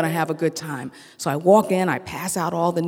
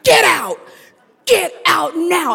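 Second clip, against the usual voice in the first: loud, high barked order; furious doctor shouting; angry shout; 12 semitones higher, 7 dB louder, slower than usual. Retold or imitated. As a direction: yell him out of the room. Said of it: "He says".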